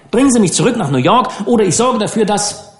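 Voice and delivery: put-on spoiled boy snapping at the skipper; indignant threat. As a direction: rattle off the threat before you're cut off. Harvey threatens, cut off.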